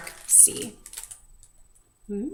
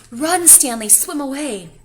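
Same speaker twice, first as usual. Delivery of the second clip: louder, urgent warning; warning call; 4 semitones higher, 6 dB louder than usual. Pretend. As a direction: urge him to flee. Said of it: "I feel like saying".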